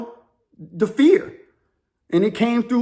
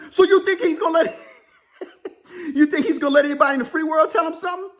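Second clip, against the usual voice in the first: laughing; 7 semitones higher, faster than usual. Real, not acted. Laughing at the idea.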